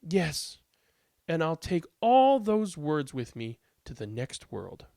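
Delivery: expressive read-aloud with lilting emphasis; picture-book narration. A narrator's reading. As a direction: read aloud to children, lively and measured.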